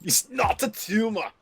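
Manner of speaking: Arnold impression. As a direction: do Arnold's high Austrian accent. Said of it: "Austrian accent ... Arnold".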